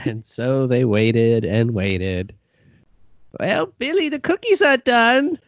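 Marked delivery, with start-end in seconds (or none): 3.4-5.4 put-on high, cheerful old granny; grandma voice. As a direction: sing out like a sweet granny. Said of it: "distract my grandma".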